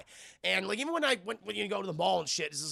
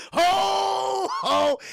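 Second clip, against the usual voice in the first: loud, high Santa laugh; mock Santa boom, loud and high; 13 semitones higher, 9 dB louder than usual. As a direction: bellow it like Santa at the door. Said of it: "He did the fucking ho, ho, ho".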